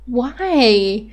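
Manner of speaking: normal talking voice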